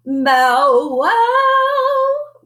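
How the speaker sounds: high, loud sung woof; a loud, high, sing-song woof; woofing bark. A narrator's reading. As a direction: bark it out like a singing dog. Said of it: "woofed Mister Muzzletuff".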